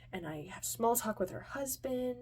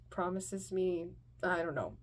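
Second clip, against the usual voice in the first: ordinary delivery, nothing marked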